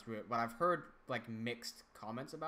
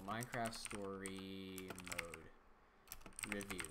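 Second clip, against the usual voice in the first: slow typing read; 5 semitones lower, 8 dB quieter, much slower than usual. Real, not acted. Typing a search query aloud.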